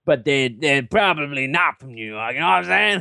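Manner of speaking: hammed-up bad New York accent. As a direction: slip into a cartoon New Jersey accent. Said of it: "New York or something".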